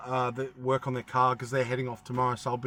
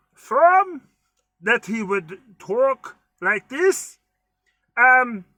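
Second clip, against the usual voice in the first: higher, slow, froggy puppet voice with a wobble; European Kermit the Frog; 5 semitones higher, 4 dB louder, much slower than usual. Pretend. Vlogger joking about which country the voice is from, Kermit-like. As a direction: play a foreign Kermit, cracking up on "from". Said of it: "it's like Kermit the Frog, European Kermit".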